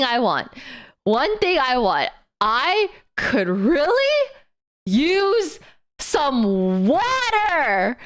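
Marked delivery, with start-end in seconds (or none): 2.4-8.0 very slow, drawn-out plea; parched longing. Retold or imitated. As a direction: drag each word out, dying of thirst. Exhausted longing.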